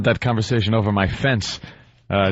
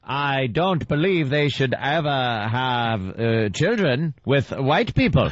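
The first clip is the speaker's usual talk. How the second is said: put-on slow, deliberate old-film delivery; mock stiff actor on camera; stilted film acting; slower than usual. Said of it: "they had no idea how to talk on film".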